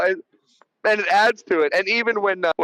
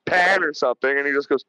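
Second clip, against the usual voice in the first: normal talking voice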